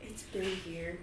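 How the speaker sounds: Aaron whispering in awe. Acted. GM voicing Aaron whispering over the city. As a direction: breathe it out hushed. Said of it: "Aaron's like".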